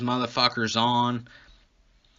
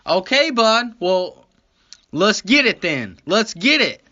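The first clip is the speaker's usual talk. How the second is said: high, loud, hammed-up readiness; imitated gung-ho cop; 8 semitones higher, 6 dB louder than usual. Cop answering the driver who gave in, loud high mock eagerness. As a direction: jump on his offer loudly and high. Cop acted starting the search.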